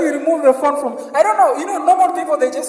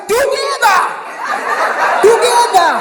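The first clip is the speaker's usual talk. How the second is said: high, loud mocking cry; hammed-up yelled together; mock shout; 8 semitones higher, 4 dB louder than usual. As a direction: shout it back in parody. Mocking the mother's line, shouted.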